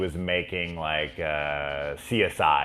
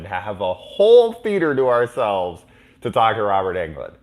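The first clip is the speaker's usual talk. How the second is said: ordinary talk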